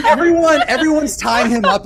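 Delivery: high, loud and laughing; giggling through the words. Real, not acted. Laughing while describing scene.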